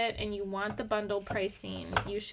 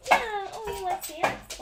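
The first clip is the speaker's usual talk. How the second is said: pet talk; 9 semitones higher, 5 dB louder than usual. Real, not acted. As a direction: coo at the dog in a squeaky voice. Offering the dog snacks.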